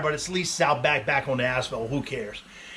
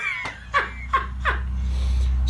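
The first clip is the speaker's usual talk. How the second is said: airy high-pitched laugh; high unvoiced laughter; high breathy laugh. Real, not acted. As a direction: let a giddy laugh escape. Laughter after realization.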